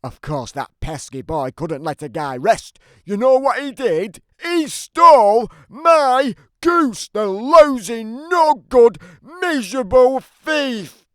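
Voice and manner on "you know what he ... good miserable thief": much higher, loud, slow furious rant; outraged rant. Acted. Giant raging about the boy who stole his goose, furious. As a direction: spit out each insult.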